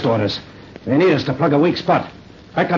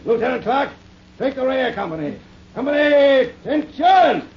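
shouting; 13 semitones higher, slower than usual. Acted. Officer calls marching orders.

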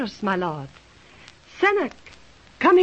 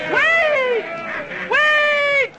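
calling out; 9 semitones higher than usual. Acted. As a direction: stop the crowd with your voice. Princess halts the angry mob.

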